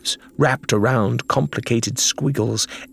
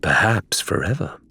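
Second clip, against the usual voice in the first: ordinary delivery, nothing marked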